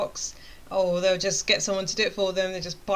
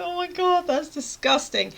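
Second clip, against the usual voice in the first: high-pitched, drawn-out revulsion; retold squealing disgust; amused disgust; 8 semitones higher than usual. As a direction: exclaim it through near-laughter. Retold laughing reaction to cake.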